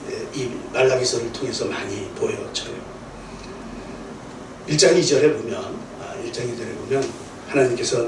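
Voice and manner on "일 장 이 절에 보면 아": ordinary delivery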